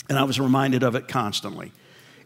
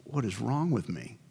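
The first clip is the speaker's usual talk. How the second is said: breathy, ashamed half-whisper; a worshipper catching his own lust, soft and ashamed; self-disgusted whisper; 3 semitones lower, 11 dB quieter, slower than usual. Retold or imitated. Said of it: "and you're like".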